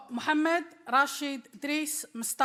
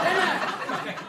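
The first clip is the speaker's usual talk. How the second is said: laughing tag; 5 semitones higher than usual. Acted. Repeats catchphrase laughing.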